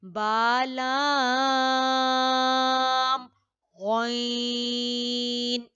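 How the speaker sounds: long chanted stretched letters; Quranic recitation demo. Shown as a demonstration. Teacher demonstrating stretched pronunciation to students, chanted. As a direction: chant बालाम गैन with each letter stretched. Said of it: "वो भी clear होना चाहिए".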